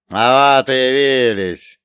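put-on slow, loud drunken slur; drunk slurring. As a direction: sneer it out, tongue tangled. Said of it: "произнёс он заплетающимся языком".

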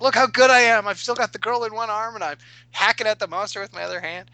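high, loud show-off boast that eases down; bragging. Retold or imitated. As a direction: strut about your own skill. Player brags after a great roll.

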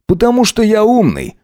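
smug smirk. A narrator's reading. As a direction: toss it off self-satisfied. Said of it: "хмыкнул Макс". (самодовольно, с ухмылкой)

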